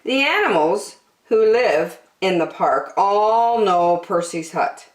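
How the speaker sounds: plain reading voice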